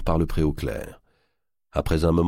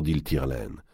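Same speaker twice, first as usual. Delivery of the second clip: ordinary delivery, nothing marked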